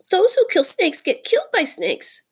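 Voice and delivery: high, mournful muskrat voice; sorrowful. A narrator's reading. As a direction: lament in a high, timid voice. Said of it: "more sorrowfully than ever".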